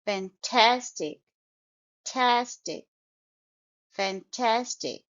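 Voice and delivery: slow Fantastic with TAS stressed; stressed second syllable. Shown as a demonstration. Teacher drilling Fantastic, slow, stress on second syllable. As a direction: break out tastic and lean hard on TAS. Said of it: "the stress is on the second syllable".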